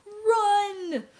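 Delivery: high, loud cry of run; frantic shout as the house falls; panicked yell. A narrator's reading. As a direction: scream at them to flee. Earthquake, pig yells run.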